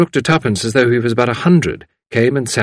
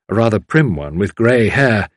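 ordinary delivery

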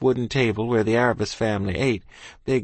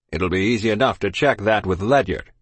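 stern captain; 3 dB louder than usual. Acted. Captain's held voice.

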